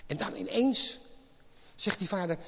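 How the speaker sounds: normal talking voice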